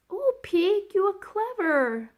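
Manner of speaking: very high, loud praise; delighted cry. A narrator's reading. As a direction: burst out in delight. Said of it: "cried Florentine".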